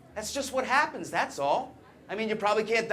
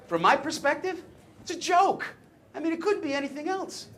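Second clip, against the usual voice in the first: pitched way up in disbelief; incredulous protest; 9 semitones higher than usual. Acted. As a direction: protest in a much higher voice. Comic protest at the controversy.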